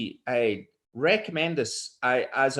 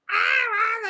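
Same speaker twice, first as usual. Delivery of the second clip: high cat squall; cat yowling; 4 dB louder than usual. Retold or imitated. The cat yowling in the sink, high squall. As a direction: let the cat screech again, high and loud. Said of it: "the cat, on he goes".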